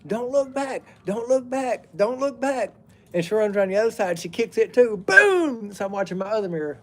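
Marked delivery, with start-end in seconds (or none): 0.1-2.7 high, shrill-free imitated holler of the girlfriend; mock hollering. Ex-girlfriend hollering at him as he leaves, high mock yell. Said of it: "she's hollering". 5.1-5.7 sudden high shouted boom; loud mock boom. Retelling her kicking the other mirror, a loud boom.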